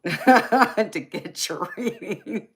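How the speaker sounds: words squeezed out slowly through laughter; can't get it out for laughing. Real, not acted. Teasing Joseph about drinking, laughing through the words.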